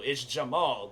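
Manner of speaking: Jamal as juh-MAHL, second syllable stressed; correct pronunciation. Retold or imitated. Setting his friend straight on his middle name, corrective. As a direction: land on the open "mahl" in Jamal. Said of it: "how to pronounce my middle name".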